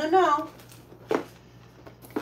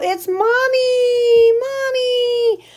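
high, slow crying cat greeting Mommy; speaking for the cat, a high slow whine; whiny cat voice; 10 semitones higher, 3 dB louder, much slower than usual. Pretend. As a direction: cry it out like a pet missing its owner. Voicing the cat greeting owner.

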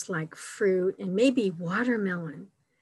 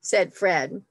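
delighted exclamation; 2 semitones higher, 4 dB louder than usual. A narrator's reading. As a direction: exclaim it brightly as a storybook bear. Reads picture book to children.

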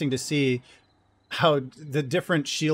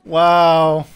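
loud laughing; 2 semitones higher, 11 dB louder than usual. Real, not acted. Laughing at teammate's blunder.

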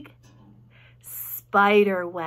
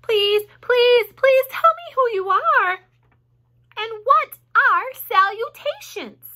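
high, loud, desperate begging; frantic piglet plea; screamed; 12 semitones higher, 9 dB louder, slower than usual. A narrator's reading. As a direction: plead at the top of your voice. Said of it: "screamed Wilbur".

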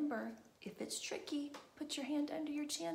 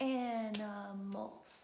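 "Animals" slow, in three separate beats; counted-out syllables; 4 dB louder, much slower than usual. Shown as a demonstration. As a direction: count out AN-I-MALS slowly in "Animals". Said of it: "Let's count it".

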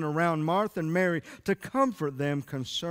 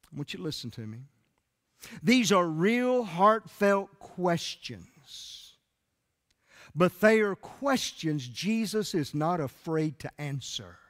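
ordinary delivery

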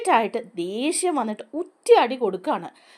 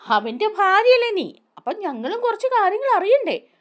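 high, jeering thug taunt; mocking taunt; 9 semitones higher, 3 dB louder than usual. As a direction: needle her with a sneering high voice. Thugs taunting Vikram's wife.

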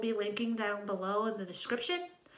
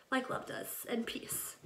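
whisper; 6 dB quieter, slower than usual. Real, not acted. Trailing aside on love.

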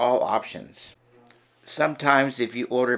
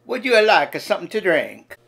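put-on higher, louder Italian-accented waiter; a hammed-up Italian accent, serving with gusto; Luigi Italian accent; 5 semitones higher, 4 dB louder than usual. Waiter Luigi welcoming diners in an Italian accent. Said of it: "Listen and learn".

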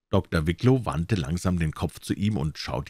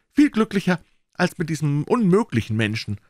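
scornful mother; 9 semitones higher, 3 dB louder than usual. A narrator's reading. Mother talks to Manfred.

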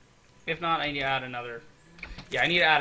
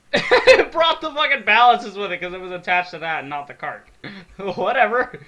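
loud, higher voice through laughter; real laughing; 5 semitones higher, 13 dB louder than usual. Real, not acted. Gamer laughing at the ballast coming along too, laughing. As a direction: laugh helplessly while explaining the mishap. Laughing at own building mishap.

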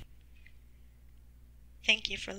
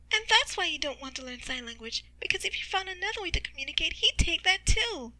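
high excited reasoning, building to a loud finish; pitched up, stretch the last words; Skitter; 8 semitones higher, 4 dB louder than usual. Acted. Character speaks in narrated story.

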